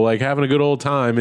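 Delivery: ordinary delivery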